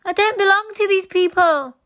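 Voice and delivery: high, loud desperate shout; a kidnapped boy crying out to the crowd for help; loud, very high pleading shout. A narrator's reading. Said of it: "shouted Oliver".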